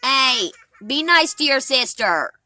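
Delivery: loud call across the yard. Real, not acted. Mom calls out to her kids.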